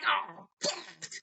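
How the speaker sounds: high startled curse; sudden high dismay; caught off guard. Retold or imitated. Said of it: "She's like".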